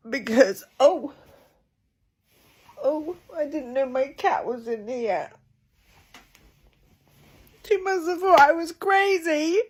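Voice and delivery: sobbing, high and breaking; ugly crying. Real, not acted. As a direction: ugly cry through the words. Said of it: "I'm doing really ugly crying".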